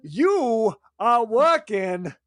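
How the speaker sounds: halting celebrity impression, very slow with odd pauses; Walken. Boss assigning the graveyard shift, halting impression. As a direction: break it up into slow, weighty chunks. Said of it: "Now you're just walking".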